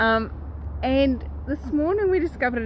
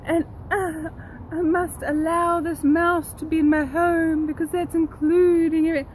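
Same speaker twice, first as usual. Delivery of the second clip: higher, syrupy mock of a gushing people-pleaser; 6 semitones higher than usual. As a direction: send up people who call it all lovely-dovely. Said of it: "so often people say".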